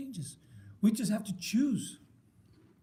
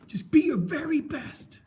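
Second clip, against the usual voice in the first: higher-pitched, rousing coach line; coach's urging; 7 semitones higher than usual. Retold or imitated. Retelling a film coach to the men, urging. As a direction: urge it like a football coach.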